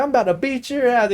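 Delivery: high-pitched imitated threat; heated dad. Dad reaching for his belt, heated threat. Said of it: "he was fucking heated".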